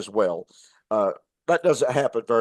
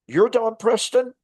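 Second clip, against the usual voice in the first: much higher, slower, disbelieving question; stunned at meeting someone famous; amazed recognition; 10 semitones higher, slower than usual. Retold or imitated. Young counselor recognizing the YouTube minister, amazed and higher.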